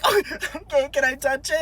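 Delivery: high tearful squeak; mock crying. Crying driver asks cop about wallet, mock tearful. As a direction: ask meekly through pretend tears. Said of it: "so I'm crying".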